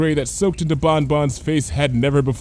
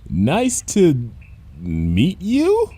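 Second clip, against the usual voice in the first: uneasy; 4 semitones higher, 3 dB louder, much slower than usual. Acted. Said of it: "in a tone of voice that".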